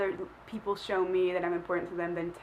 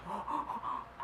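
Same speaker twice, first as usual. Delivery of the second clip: soft gasp; 3 semitones higher, 12 dB quieter than usual. Real, not acted. Sudden realisation.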